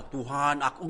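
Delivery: demonstrating an overloud plea; mock dramatic prayer. Shown as a demonstration. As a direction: call out to God in an overdone way. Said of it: "enggak, enggak gitu".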